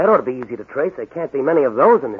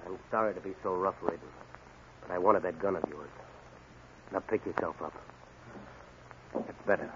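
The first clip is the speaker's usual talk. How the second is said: low, soft, almost under breath; quiet after the fight; 6 semitones lower, 18 dB quieter than usual. Acted. Hero tells the beaten gunman to get up, quiet and low. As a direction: talk him down gently now.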